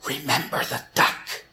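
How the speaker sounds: hushed, menacing whisper; whispered threat. Acted. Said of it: "she whispered".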